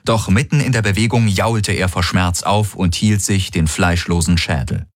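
plain reading voice

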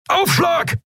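high, urgent yell of Aufschlag!; roaring warning. A narrator's reading. Helmsman warning the crew of the impact, roaring. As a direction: bellow the warning before the hit. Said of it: "brüllte Keru".

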